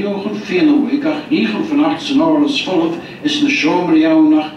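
ordinary talk, nothing marked

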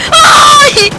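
very high, loud shriek; startled scream. Real, not acted. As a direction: scream from a sudden fright. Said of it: "¡Uy, me asustó!".

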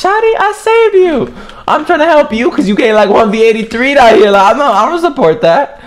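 high, whiny protest; exasperated whine. Real, not acted. Gamer complaining to teammate he saved, high whiny. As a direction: whine that you only helped.

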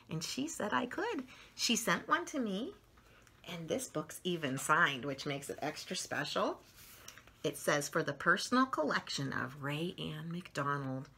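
usual speaking voice

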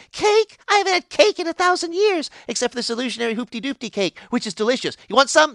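high, eager babbling; childlike giddy pixie voice; giddy excitement. Retold or imitated. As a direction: gush over the cake.

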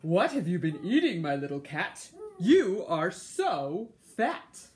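a snooty, unimpressed neighbor; snooty bored cat. A narrator's reading. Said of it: "Skohottentot said to him".

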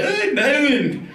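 high, slow mock Irish voice; Irish guy. Rich Irish guy bragging, high and hammed-up. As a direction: ham it up slowly. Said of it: "He's like".

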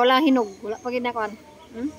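eating between words; chewing while talking. Real, not acted. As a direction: mouth partly full of fruit. Seen 2: Hand feeds fruit to mouth while speaking; eating throughout labelled words.

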